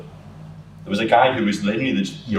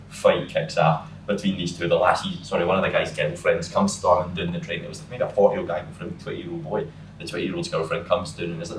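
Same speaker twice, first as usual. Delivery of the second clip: ordinary talk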